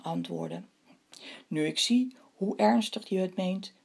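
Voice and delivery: expressive storytelling with animated character lines; story read to children. A narrator's reading. Telling children the legend of the moon and the hares, storytelling. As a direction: read a legend aloud, unhurried with dramatic pauses.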